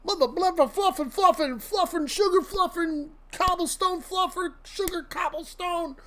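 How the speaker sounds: high, soft, sing-song fake cursing; a playful made-up cursing demo; mock venting rant. Shown as a demonstration. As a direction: rattle off silly stand-in swear words to blow off steam. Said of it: "Hell, we'll set a timer".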